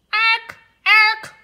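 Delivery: loud, high screeching jay; squawking jay call. Acted. Bird call in read-aloud story.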